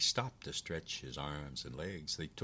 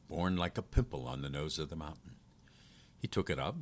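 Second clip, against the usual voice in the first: ordinary delivery, nothing marked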